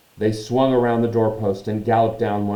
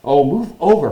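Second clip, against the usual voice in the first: loud, higher impatient snarl; 7 semitones higher, 10 dB louder than usual. Acted. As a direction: snarl it, fed up. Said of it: "Hermione snarled".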